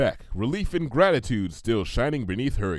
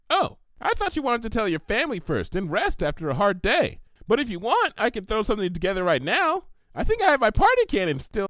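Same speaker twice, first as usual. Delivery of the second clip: high, bubbly cartoon pony voice; chirpy and eager, pitched way up; Pinkie Pie voice; 11 semitones higher than usual. Pinkie offering Applejack a party, high and bubbly.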